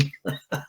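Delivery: soft chuckle; real, quiet run of chuckles; laugh. Real, not acted. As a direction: chuckle softly after the story. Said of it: "laughing now".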